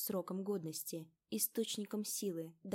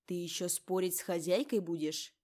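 angry; 7 dB louder than usual. A narrator's reading. Lady scolds guard before slap.

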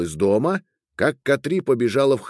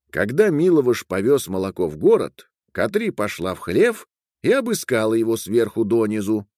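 plain reading voice

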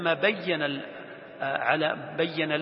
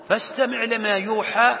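higher, louder recited phrase; Quran recitation; 7 semitones higher, 6 dB louder than usual. Acted. Teacher returning to God's command, reciting. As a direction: lift and project the verse. Repeats verse after explanation.

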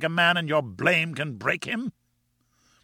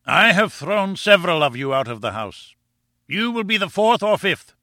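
raised, emphatic warning; angry threat; 8 semitones higher, 4 dB louder than usual. A narrator's reading. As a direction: threaten him with mounting anger. Professor threatens to throw visitor out.

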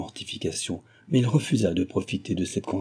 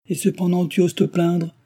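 raised, high-pitched reproach; exclaiming; 9 semitones higher, 4 dB louder than usual. A narrator's reading. He rebukes Cécile for daring to complain, exclaiming. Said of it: "s'écria-t-il".

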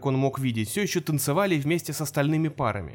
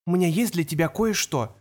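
ordinary delivery

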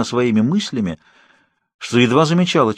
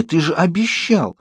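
higher, slow, barely contained outrage; held-back indignation; 6 semitones higher, slower than usual. A narrator's reading. Bella accuses Edward of breaking his promise, indignant. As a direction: hold your anger back, just barely. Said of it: "едва сдерживала негодование".

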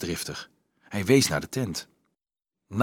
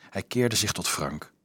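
plain reading voice

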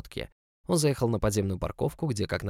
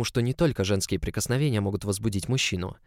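ordinary delivery, nothing marked

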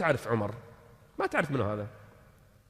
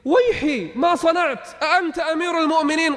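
much higher, alarmed exclamations; startled dismay; 12 semitones higher, 5 dB louder than usual. Acted. Old woman realizing she blamed the caliph to his face, shocked. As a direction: gasp it out in shock.

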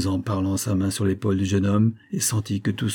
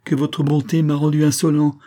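high, loud, wounded bitterness; raised voice, bitter reproach; bitter outburst; 7 semitones higher, 6 dB louder than usual. A narrator's reading. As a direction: fling the bitter words at her. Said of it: "s'écria-t-il avec amertume".

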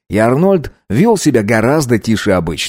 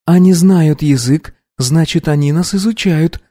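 thoughtful; 7 semitones higher than usual. A narrator's reading. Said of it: "задумчиво сказала Анна".

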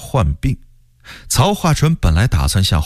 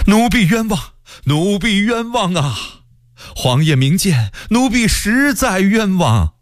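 terrified servant protesting; frightened pleading; 11 semitones higher than usual. A narrator's reading. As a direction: beg the emperor desperately in a high voice. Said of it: "曹化淳十分的害怕".

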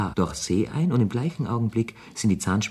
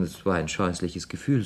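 ordinary talk

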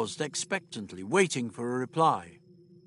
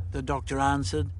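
ordinary delivery, nothing marked

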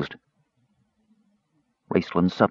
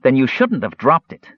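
scolding; 4 semitones higher than usual. A narrator's reading. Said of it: "the Kender scolded".

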